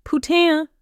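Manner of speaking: high, loud startled French curse; sudden jolted exclamation; startled outburst. A narrator's reading. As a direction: yelp it out, caught off guard. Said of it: "a startled sound escaping his throat".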